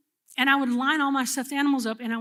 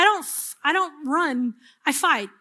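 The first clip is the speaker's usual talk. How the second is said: ordinary talk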